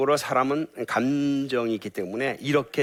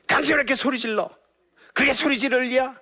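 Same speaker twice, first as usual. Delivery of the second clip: high, loud accusing wife; angry wife shouting; 14 semitones higher, 5 dB louder than usual. Shown as a demonstration. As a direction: yell it like a furious wife. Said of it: "이게 아니라 너". (남편에게 소리 지르는 화난 아내, 높고 크게)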